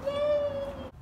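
high happy yay; excited yay. Real, not acted. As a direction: cheer like you can't wait. Cheering before seeing the dog.

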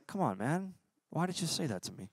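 very soft mock-wounded reply; small, quiet voice of the insulted; hurt mumble. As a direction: protest softly, as if stung. Imagined hurt reply to taunts.

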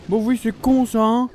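high, loud mocking jeer; loud gloating taunt. Real, not acted. Gamer taunting the beaten opponent, loud and gloating. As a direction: rub the win in his face.

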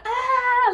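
high, loud mock shriek; comic fake scream; imitated scream. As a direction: yelp like someone running from love. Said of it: "them going".

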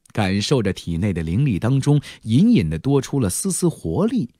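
plain reading voice

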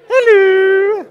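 very high loud sing-song hello; high hello for Mary. Shown as a demonstration. Mary prompt during story.

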